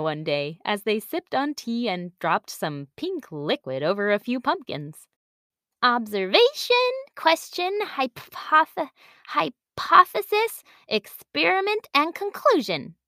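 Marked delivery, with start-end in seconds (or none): 5.8-12.9 lightly voiced child reciting proudly; eager little girl. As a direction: list them off like a keen pupil. Girl recites the scientific method.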